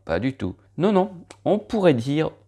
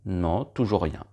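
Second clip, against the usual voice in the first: ordinary delivery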